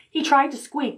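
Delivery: plain reading voice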